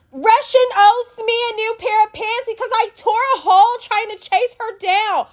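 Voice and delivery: high put-on whiny voice; complaining. Lex complaining about her torn pants, high mock whine. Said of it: "talking about".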